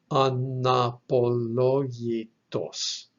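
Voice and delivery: "anapologitos" sounded out slowly, syllable by syllable; slow pronunciation demo. Shown as a demonstration. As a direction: draw out each syllable of "anapologitos" for learners. Teacher sounds out new Greek word.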